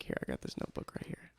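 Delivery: quick unvoiced whisper into the mic; ASMR whisper. Shown as a demonstration. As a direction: show off the notebook in an ASMR whisper. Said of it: "I'm doing A S M R right now".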